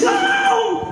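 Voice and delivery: a high, piercing imitated baby wail. Preacher to congregation, mimicking a crying baby's high wail. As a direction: wail like a hungry newborn.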